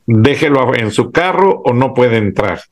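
normal talking voice

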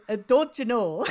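put-on a clumsy stab at a Wisconsin accent. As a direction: try a Midwest Wisconsin twang, not quite landing. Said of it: "he will be talking in a Wisconsin accent".

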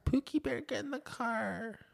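very high, soft mock sweet-talk; cutesy boyfriend impression. Mimicking a guy calling his dumped girlfriend back, mock cutesy. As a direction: coo it in a tiny, high pet-name voice.

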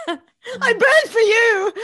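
high, loud, slow hammed-up wail; mock crying. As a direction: wail the love line as if crying. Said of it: "like, cried".

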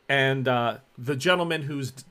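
usual speaking voice

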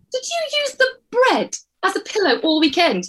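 high, laughing incredulous question; laughing disbelief. Real, not acted.

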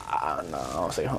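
low, hushed reply; subdued answer. Acted. Son answering a parent's call, subdued. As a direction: drop it down low and soft. Acting his own dutiful answer.

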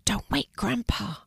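hushed, whispery plea; hushed warning. A narrator's reading. Warning someone not to wake Grandpa, hushed. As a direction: shush them gently, keep it secret.

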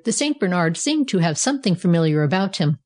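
plain reading voice

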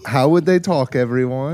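put-on British accent. Host prompting everyone to join in, British accent. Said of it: "they would talk like this".

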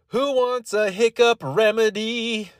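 slow, high sales pitch; hammy infomercial host; announcer voice. Acted. Announcer-like offer.

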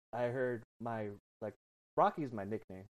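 ordinary delivery

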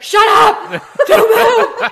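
very high, full-volume shout; retold screamed command. Strangers silencing a confused woman in danger, screamed command. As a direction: scream it urgently, cutting off any questions. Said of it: "start screaming at me".

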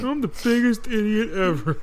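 high put-on voice of Hooper; Hooper impression. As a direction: play it as a goofy Hooper. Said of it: "your Hooper impression sounds like Kyle".

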